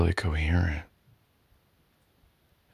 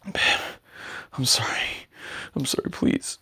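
pleading; 7 semitones higher than usual. Acted. Begging partner.

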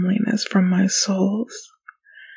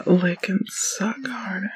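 whispering; 6 dB quieter than usual. Acted. Whispered command.

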